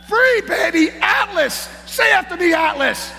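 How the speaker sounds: high, loud call for the crowd to echo; rousing call-out. Real, not acted. Speaker getting the audience to repeat after him, rousing shout.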